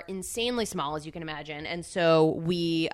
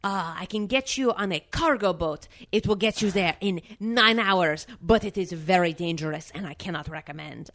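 imitated French desk clerk, deliberate wording; mock French receptionist giving a warning; French accent. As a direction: put on a muddled French accent. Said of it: "with a very heavy French accent".

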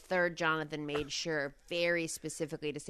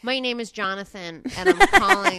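Australian accent; 5 semitones higher, 10 dB louder than usual. Shown as a demonstration. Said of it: "Maybe just say".

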